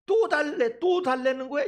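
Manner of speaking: loud, high-pitched exasperated mom; scolding mother. Acted. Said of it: "아이들이 엄마한테".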